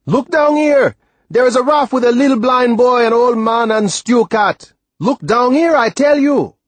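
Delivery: very high, sustained loud calling; desperate shout. A narrator's reading. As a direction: shout to be heard far overhead. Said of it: "Timothy shouted".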